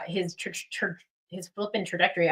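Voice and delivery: tripping over the word, restarting it; stuttering. Real, not acted. Host jazzed up about a young artist's future, stuttering. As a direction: stumble on the first syllable, then get it out. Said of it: "stuttering so much today".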